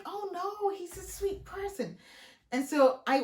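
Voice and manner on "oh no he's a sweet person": naive high friend voice. Retold or imitated. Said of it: "She's like".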